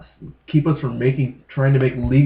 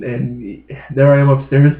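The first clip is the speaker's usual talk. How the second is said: usual speaking voice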